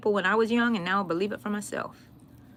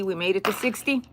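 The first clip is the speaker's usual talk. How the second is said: ordinary delivery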